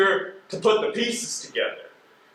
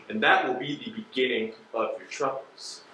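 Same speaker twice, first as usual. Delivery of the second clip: low menace; 7 semitones lower, 7 dB quieter than usual. Acted. Investigator lists consequences menacingly.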